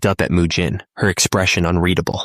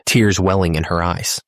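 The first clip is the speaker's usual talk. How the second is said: plain reading voice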